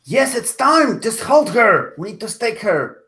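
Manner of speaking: high, urgent cry back; acted urgency. Alric to Amanda while wrestling the vampire, urgent cry. Player answers ally mid-fight.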